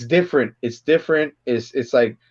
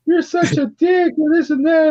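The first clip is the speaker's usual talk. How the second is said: much higher, slow, laughing through it; retelling Marla's insult, high and amused; mock woman's insult; 12 semitones higher than usual. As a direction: quote her scornful jab in a high voice. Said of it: "He's like".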